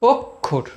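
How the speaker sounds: অক্ষর as two detached syllables, অক্ + ষর; split into syllables. Shown as a demonstration. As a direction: pause between the two beats of অক্ষর. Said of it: "দুটো সিলেবেলে ভাগ হচ্ছে". (অক্ষর দুই ভাগে ভেঙে, মাঝে থেমে)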